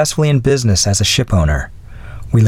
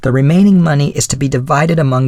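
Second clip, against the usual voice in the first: put-on lightly raised voice of a gracious old lady; a hint of the old widow's voice; elderly lady voice; 7 semitones higher than usual. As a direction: speak as a kindly older woman. Mrs Callender continues.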